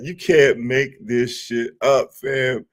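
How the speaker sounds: slow, incredulous run of words; laughing disbelief. Real, not acted. Streamer to viewers after a clip, amused disbelief. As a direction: draw the line out in amused disbelief. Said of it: "the reason why I'm laughing so hard".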